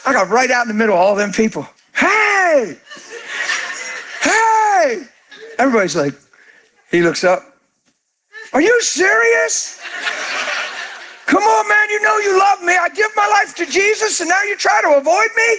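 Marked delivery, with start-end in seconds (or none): 1.9-5.1 high, shouted hey across a crowd; high shouted greeting; calling out. Retold or imitated. 8.5-15.5 high laughing teasing call. Retold or imitated. Teasing friend loudly in crowd.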